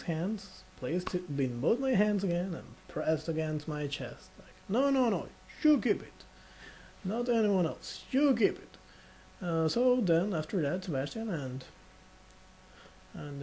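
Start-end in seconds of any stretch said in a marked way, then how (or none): 4.7-8.0 put-on higher, pressing old man; a hint of an insistent old man. 8.1-8.8 higher, pressing repeat of the demand; a hint of an insistent old man, pushing harder. Repeated insistence, more forceful.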